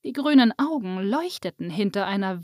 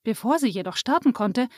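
ordinary delivery

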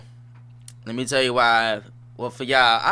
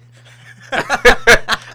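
loud high laugh; 13 semitones higher, 13 dB louder than usual. Real, not acted. Laughs at incriminating himself.

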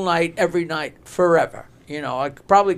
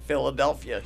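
soft, low struggle to get the word out; stuttering; 4 semitones lower, 6 dB quieter than usual. Acted. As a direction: act out a stutterer labouring through a city name. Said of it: "they're all stuttering".

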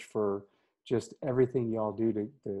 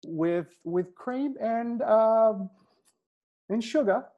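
attempted Australian accent; 10 semitones higher, 3 dB louder, slower than usual. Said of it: "With an Australian accent".

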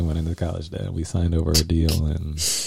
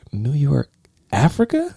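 imitating her baffled high rise on Africa; mock puzzled echo, pitch shooting up at the end; a hammed-up confused woman; confused question; 8 semitones higher than usual. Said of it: "her response is".

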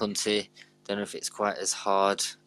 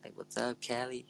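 light mock Californian, softly raised; California accent; 4 semitones higher, 3 dB quieter than usual. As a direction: imitate a laid-back Californian greeting. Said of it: "like, the whole California thing".

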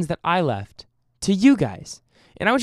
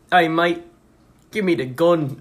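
mock Australian accent; 4 dB louder than usual. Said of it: "That's not Australian".

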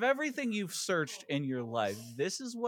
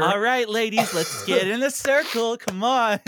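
rallying call; 7 semitones higher, 6 dB louder than usual. Pretend. Mock rallying group.

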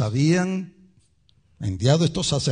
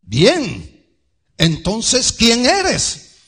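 loud, high-pitched insistent questioning; questioners pressing; 8 semitones higher, 7 dB louder, much slower than usual. Acted. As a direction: press him loudly for an answer.

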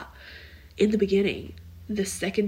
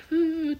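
high, louder imitated wail; mock wail; 10 semitones higher, 5 dB louder than usual. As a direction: imitate someone wailing in the night. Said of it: "like, wailing".

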